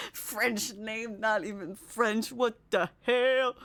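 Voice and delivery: slow, drawn-out imitation of an offended customer; mock betrayed. Imitating a customer upset she wasn't French, mock betrayed. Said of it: "he was totally betrayed".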